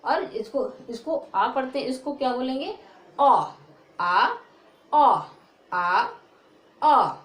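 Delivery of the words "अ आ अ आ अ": loud, drawn-out अ and आ said by turns; teacher drilling अ and आ aloud; drilled vowel sounds. Shown as a demonstration. Teacher drilling pupils on vowels, loud and drawn out. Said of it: "बोलना भी सीख लो".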